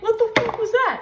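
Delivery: high, loud startled cry; mock jump-scare shriek; shocked outburst. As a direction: yelp it like something just jumped out at you. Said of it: "And you're like".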